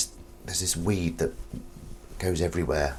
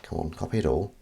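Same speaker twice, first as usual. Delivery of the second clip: ordinary talk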